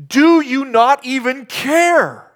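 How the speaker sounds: high, loud, slow reproach; rebuking accusation. Retold or imitated. Disciples rebuking sleeping Jesus, loud and slow. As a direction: accuse him of not caring. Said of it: "It's a rebuke".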